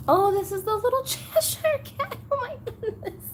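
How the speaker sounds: very high delighted squeal; thrilled discovery. Real, not acted. Collector finding the little Cheshire cat, high thrilled squeal. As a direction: squeal with excitement, breaking into a laugh.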